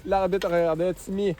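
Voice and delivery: rising call up to a goal; excited sports commentary. Real, not acted. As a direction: build to the goal, then cry out. Live play-by-play to the goal.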